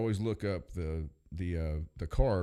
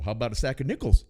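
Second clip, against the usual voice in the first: louder, cheeky teller line; mock coworker joke; 4 semitones higher, 8 dB louder than usual. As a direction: toss out the corny joke. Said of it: "he'd go".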